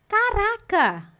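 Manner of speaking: drawn-out wow of a tourist; amazed exclamation. Pretend. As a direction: gasp it out in awe. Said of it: "amazed exclamation".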